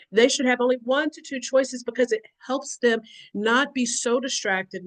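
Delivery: ordinary delivery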